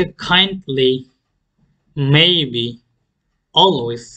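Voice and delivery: kindly, maybe, always strong on KIND, MAY, AL; first-syllable stress. Shown as a demonstration. Teacher models two-syllable adverbs, first-syllable stress. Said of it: "first সিলেবলে stress করব".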